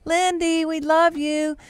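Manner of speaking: put-on very high child's call; retold high singsong child; little daughter calling out. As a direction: imitate a small girl calling to someone far off. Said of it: "And she goes".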